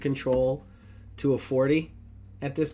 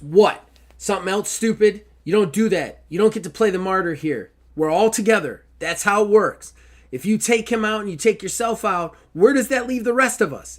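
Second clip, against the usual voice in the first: raised, higher voice, angry and near tears; angry plea; 7 semitones higher, 6 dB louder than usual. Acted. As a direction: let her anger spill out over the tears. GM voices Aubrey's angry emotional plea.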